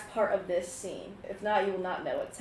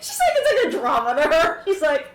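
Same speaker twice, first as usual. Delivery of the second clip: high, loud, laughing outburst; laughing exclamation; 8 semitones higher, 11 dB louder than usual. Real, not acted. Game master cracks up at a player reacting like it's a drama, laughing loudly.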